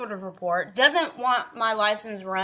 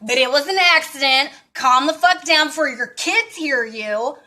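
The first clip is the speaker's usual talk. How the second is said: mock angry telling-off; 5 semitones higher, 5 dB louder than usual. Hurling it at the rude driver, loud and high. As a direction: snap back louder and higher, spelling every word out. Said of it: "I was just like".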